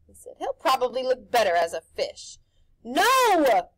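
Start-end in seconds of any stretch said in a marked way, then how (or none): 0.4-2.4 loud, high showman pitch; pushy grinning tempter; Rictus's brash voice. A narrator's reading. Rictus cruel joke. 2.8-3.7 loud, high cry of protest; Harvey yells at the house. A narrator's reading. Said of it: "Harvey yelled".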